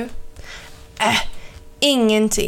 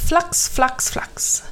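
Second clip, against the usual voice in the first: viskat flax; 6 semitones higher, 3 dB quieter, slower than usual. A narrator's reading. Kråkan flyger iväg.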